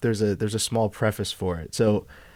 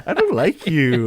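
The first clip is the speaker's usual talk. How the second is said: higher-pitched cute voice with a sting; cutesy but venomous; 4 semitones higher, 3 dB louder than usual. Retold or imitated. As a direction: imitate passive-aggressive lovey talk. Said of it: "It's, like, cutesy, but has, has this, like, venom to it".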